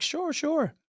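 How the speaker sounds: high, quick eager sure; eager acceptance. Retold or imitated. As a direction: jump at the job offer. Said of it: "I'm like".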